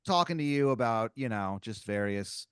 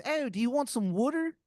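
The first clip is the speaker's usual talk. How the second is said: much higher, "water" said "wooder" in a thick hammed-up Philadelphia accent; awful Philadelphia accent. Driver repeating his water offer in a thick Philly accent. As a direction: lean hard on the Philadelphia "wooder". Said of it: "That's the Philadelphia accent?".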